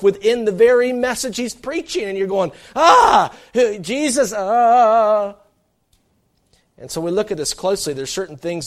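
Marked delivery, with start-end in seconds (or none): 2.7-5.4 much higher and louder, a stunned cry; exasperated outcry. Retold or imitated. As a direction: cry out as if startled and dismayed. Listener's exasperated outcry voiced.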